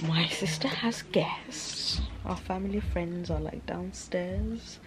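slow soft whisper. Real, not acted. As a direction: hush so guests don't hear. Guests downstairs, keeping quiet.

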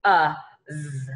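short "uh", then one separate z; sounded out. Shown as a demonstration. Teacher demonstrates short U and one Z, sounded out. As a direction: sound out "uh, z" in pieces. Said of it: "I have the short U sound".

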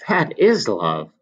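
put-on young man overjoyed at the thought; cried out. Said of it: "cried Alan".

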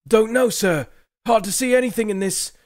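high, loud voice of a nervous northern soldier; rough soldier Dirk. Acted. Dirk reports to his commander Morgrig, high anxious soldier voice. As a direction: answer your commander, anxious and eager.

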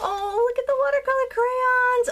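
very high, thrilled squeal; excited squeal. Real, not acted. Crafter seeing watercolor crayons inside, excited squeal. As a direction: squeal with delight over a treasure. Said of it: "totally gets me excited".

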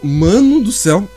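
stunned, drawn out. Real, not acted. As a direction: stretch the words, still in shock. Recovering from the scare. (arrastado, em choque)

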